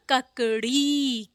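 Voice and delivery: ककड़ी with drawn final "reee"; long-ee ending on ककड़ी. Shown as a demonstration. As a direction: stretch the last vowel of ककड़ी. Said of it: "बड़ी की मात्रा ड़ा में".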